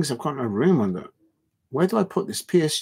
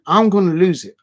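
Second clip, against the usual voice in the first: much louder, higher repeat of the warning; riled-up outburst; 4 semitones higher, 8 dB louder than usual. Real, not acted. Streamer warning rival fans he'll crack, loud and exasperated. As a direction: burst out as if about to snap.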